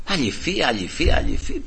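soft puzzled repetition of αληφή, rising each time; baffled repeating. Retold or imitated. Preacher puzzling over the woman's strange word αληφή, quietly baffled. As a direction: mutter αληφή over and over, not getting it. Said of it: "δεν πήγαινε το μυαλό μου".

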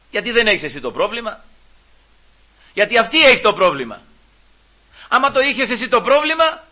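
forceful questions with high, pressing delivery; confrontational preaching. Acted. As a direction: rebuke the disciples from the pulpit. Preacher challenges the disciples.

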